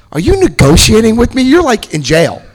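high, loud disbelieving cop; incredulous cop. Retold or imitated. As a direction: sound floored by the nerve of it.

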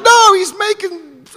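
high, loud pleading cry; high loud frantic intervention; frantic shout. Retold or imitated. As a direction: shout it to hold back her sister. Girl shouting to stop sister.

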